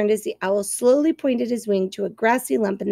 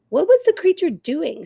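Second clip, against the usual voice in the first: higher, eager weasel question; curious weasel; 6 semitones higher than usual. Acted. Weasel asks the owl, higher anxious voice. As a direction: ask like a nervous little weasel. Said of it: "said Dart the Weasel".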